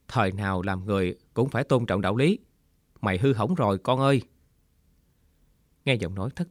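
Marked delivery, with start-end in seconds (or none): none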